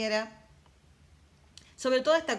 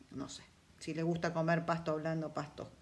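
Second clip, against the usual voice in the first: joking aside; 3 semitones lower, 6 dB quieter than usual. Real, not acted. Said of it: "nah, eso es un chiste".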